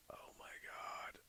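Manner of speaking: breathless hushed whisper; soft stunned whisper; awed whisper. Real, not acted. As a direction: whisper it in disbelief. Stunned by a card.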